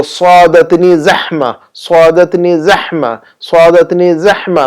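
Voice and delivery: slow, emphatic صادتنی زحمہ; energetic pronunciation. Shown as a demonstration. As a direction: put energy into each repeat of صادتنی زحمہ. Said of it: "تھوڑا سا energy چاہیے اس میں".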